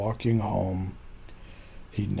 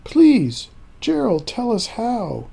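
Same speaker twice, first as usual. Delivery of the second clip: eager pleading; 9 semitones higher, 3 dB louder than usual. Acted. Animals begging Gerald.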